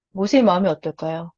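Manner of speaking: usual speaking voice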